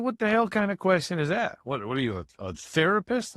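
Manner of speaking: mock-indignant, pitched high; retold indignant retort. Young prisoner retorting to the man across the hall, mildly indignant. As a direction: throw the question back, imitating irritated disbelief.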